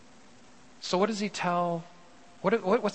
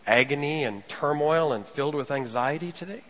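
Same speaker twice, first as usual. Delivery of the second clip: normal talking voice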